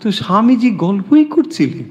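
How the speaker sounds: ordinary talk, nothing marked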